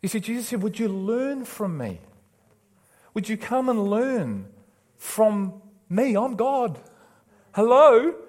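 high, loud, urgent preaching; full-force preaching. Real, not acted. Preacher pleading with people killing themselves, impassioned full-force preaching. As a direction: plead with them from the pulpit, pitched high.

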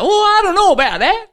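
high, loud hesitant wife; wife doubtful about the bet, high and loud. Acted. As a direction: hold back, unsure, as the wife. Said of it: "And she's like".

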